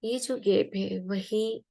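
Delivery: unhurried meditation guide, long pauses between phrases; guided meditation. Real, not acted. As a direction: guide listeners gently into relaxation, leaving room to breathe.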